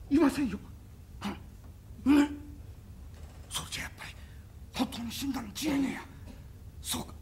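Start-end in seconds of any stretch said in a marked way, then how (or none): 3.5-7.1 hushed unvoiced whisper; a shaken whisper, realizing the master is dead. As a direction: murmur it breathily, shaken.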